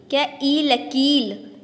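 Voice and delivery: लकील with the ई held long, spelled very slowly; drawn-out long vowel. Shown as a demonstration. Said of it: "इसे खींच के बोलेंगे". (लकील में ई को खींचकर बोलना)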